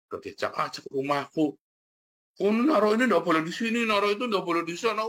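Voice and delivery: annoyed homeowner. Retold or imitated. Homeowner scolding the careless helper, mock annoyed and fast. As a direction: fuss like a homeowner whose things were moved. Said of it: "yang punya rumah jengkel".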